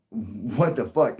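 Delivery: stunned drawl. Retold or imitated. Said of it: "we're all just like".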